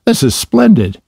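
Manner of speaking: high, gleeful storybook exclamation; excited delight. A narrator's reading. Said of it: "he cried, jumping up upon seeing them".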